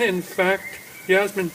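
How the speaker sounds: plain reading voice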